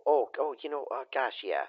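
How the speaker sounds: ordinary delivery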